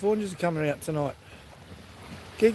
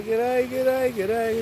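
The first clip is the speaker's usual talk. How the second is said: higher, brighter Australian "G'day" said three times; cheery Aussie greeting; 5 semitones higher, much slower than usual. Shown as a demonstration. Host coaching viewers to say g'day, higher cheery Australian. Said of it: "You say".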